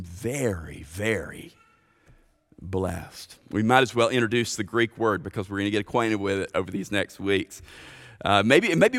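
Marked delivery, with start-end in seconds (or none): 0.0-3.4 low, soft and slow; quiet, weighty landing with pauses; hushed emphasis. Real, not acted. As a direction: drop the voice and space out each word. Emphatic climax dropped to a hush.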